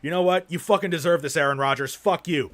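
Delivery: a fan cursing out his own team's star, loud and heated; angry rant. Real, not acted. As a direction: tell him off like a furious fan. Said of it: "Ranting too much".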